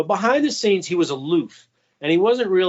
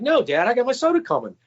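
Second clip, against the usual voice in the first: mock kid protest, higher and fast; 9 semitones higher, faster than usual. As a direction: push back like a kid who wants his soda.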